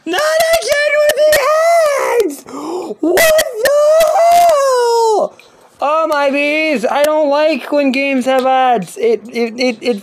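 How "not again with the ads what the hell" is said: very high-pitched shriek of frustration; outraged screaming. Real, not acted. Player hit by ads again, outraged screaming. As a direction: shriek in real exasperation.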